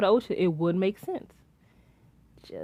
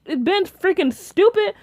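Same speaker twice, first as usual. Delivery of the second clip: exasperated; 10 semitones higher than usual. Real, not acted. Frustrated rant.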